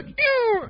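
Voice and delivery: sudden high-pitched mock yelp; imitated yelp. Man mimicking the noise his partner made, mock yelp. Retold sound heard during sex.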